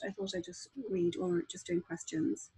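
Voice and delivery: ordinary delivery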